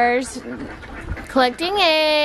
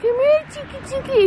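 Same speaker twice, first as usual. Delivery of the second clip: much higher sing-song call; imitating son calling; 12 semitones higher than usual. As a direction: coax the rooster like a little boy. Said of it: "You were like".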